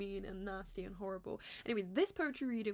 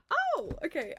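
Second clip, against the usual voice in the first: sudden recall; 12 semitones higher, 7 dB louder than usual. Real, not acted. Suddenly remembers.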